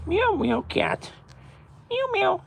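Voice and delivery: squeaky high meowing; high cat meow. Pretend.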